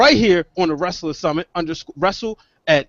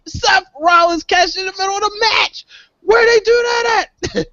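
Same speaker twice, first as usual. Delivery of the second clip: much higher, shouting in disbelief; high, excited disbelieving shout; amazed shout; 13 semitones higher than usual. Real, not acted. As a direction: yell it like you can't believe it. Amazed shout about the cash-in.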